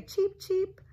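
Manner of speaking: high squeaky cheeps; chick cheep. Acted. Parent voicing the chicks, high squeaky cheeps. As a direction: peep it like baby chicks. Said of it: "The chicks say".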